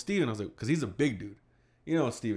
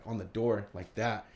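ordinary talk

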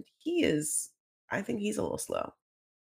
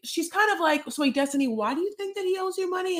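mock Sarah; 11 semitones higher than usual. Sarah voiced, high mock.

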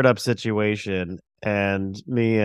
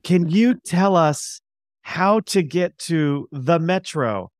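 slow, higher, spelled-out tourist English; over-enunciate like talking to foreigners; overly slow English; 7 semitones higher, slower than usual. Retold or imitated. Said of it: "talking English very slowly".

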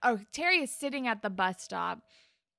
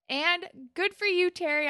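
ordinary delivery

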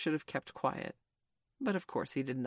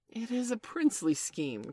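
higher-pitched cry of approval; exclaiming; 7 semitones higher than usual. A narrator's reading. Hook approving Smee's kidnap scheme, exclaiming. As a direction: cry out in delight at the plan. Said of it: "cried Hook".

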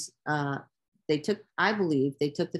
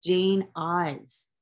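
usual speaking voice

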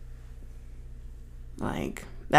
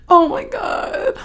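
high overwhelmed oh my God; 14 semitones higher, 6 dB louder, slower than usual. Real, not acted. Overcome by sad movie memory.

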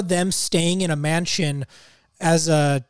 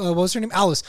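normal talking voice

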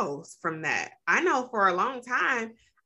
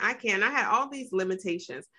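ordinary talk, nothing marked

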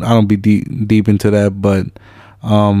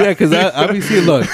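laughing; 8 semitones higher than usual. Real, not acted. Laughing at own joke.

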